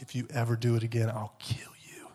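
fast, whispered mom threat; whispered threat trailing to nothing; menacing threat. Retold or imitated. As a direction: hiss it like a furious mother. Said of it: "She's like".

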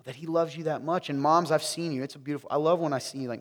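ordinary delivery

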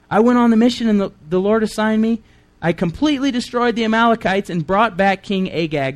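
high, defensive insistence; high-pitched self-justifying account; Saul protesting. Acted. Reader voices Saul's defensive protest.

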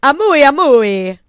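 put-on high, slow, loud Italian-accented moo; sing-song put-on Italian cow. Co-host offers Italy's cow, high slow Italian moo. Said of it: "Italy would be".

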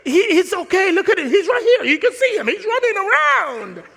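high, loud and amused; laughing plea. Retold or imitated. Referee pointing to the boy, high and laughing. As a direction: plead through laughter.

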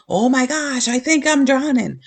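high, panicky cry of drowning; imitating someone gasping that they're drowning; mock panic. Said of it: "tempted to panic and say".

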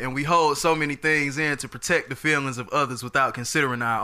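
[name] voice. Acted. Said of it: "I sound like [name]".

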